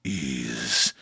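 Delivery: very low, slow, hushed mystic; mysterious candle seller. Acted. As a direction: intone directions slowly, sinking deep and soft. NPC mysteriously giving directions.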